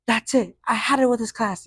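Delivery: fed-up teacher. Retold or imitated. Teacher quitting on her class, mock upset. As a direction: mimic the teacher losing it. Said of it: "she goes".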